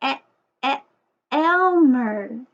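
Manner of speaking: "E-, e-, Elmer" with the first e split off; sounding out the E. Shown as a demonstration. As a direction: isolate the e before "Elmer". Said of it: "starts with the E sound too".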